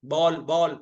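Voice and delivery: बॉल with the open o between aa and o; बॉल with the half-open ऑ vowel; bɔl vowel. Shown as a demonstration. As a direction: say बॉल halfway between baal and bol. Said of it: "आ के और ओ के बीच की ध्वनि है".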